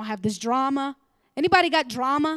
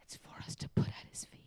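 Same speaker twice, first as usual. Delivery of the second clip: soft, weighty whisper; 12 dB quieter than usual. Real, not acted. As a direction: let it land in a whisper. Whispered point.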